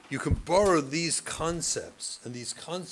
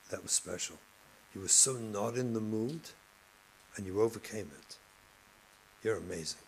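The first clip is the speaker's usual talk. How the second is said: warm praise; 5 semitones lower, 5 dB quieter, faster than usual. Retold or imitated. Teacher praising student at recess.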